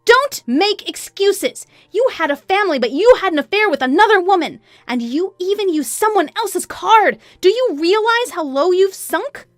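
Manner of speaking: high, loud, unleashed anger; furious outburst. A narrator's reading. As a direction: let out all the held-in rage. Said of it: "I let out all the anger".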